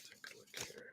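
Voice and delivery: whispered to herself. Real, not acted. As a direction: breathe it to yourself while dealing. Whispering while shuffling cards.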